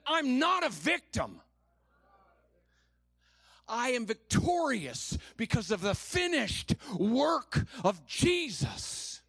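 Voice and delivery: slow, high, forceful declaration of faith; with full belief. Shown as a demonstration. Preacher showing church how to believe it, full conviction. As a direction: proclaim it with conviction, stretching the words. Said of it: "say it like you believe it".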